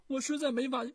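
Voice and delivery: high, loud and weeping; tearful. Acted. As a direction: cry it out through tears. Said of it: "太子泪流满面".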